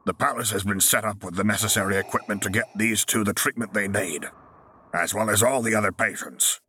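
lightly voiced senior medic; a hint of a crisp medic; brisk medic voice. Medic's voice continues.

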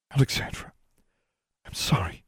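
soft, hushed plea; subdued apology. A narrator's reading. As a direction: apologise barely above a murmur. Shaken apology after confession.

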